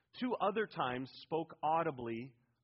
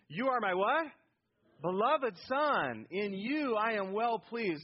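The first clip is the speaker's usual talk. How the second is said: loud, higher, drawn-out declaration; emphatic affirmation; 5 semitones higher, 5 dB louder, slower than usual. Shown as a demonstration. Preacher showing congregation how to say the verse, emphatic affirmation. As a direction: proclaim it slowly with full conviction. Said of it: "Oh, you say that like, you know".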